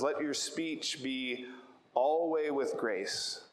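медленно и весомо читает стих, выделяя каждое слово; slow scripture reading. Shown as a demonstration. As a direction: read the verse slowly, weighing each word. Quoting scripture for emphasis.

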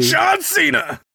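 high, booming wrestling announcer call; announcer shout. Pretend. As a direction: yell it like a ring announcer. Announcer-style shout of name.

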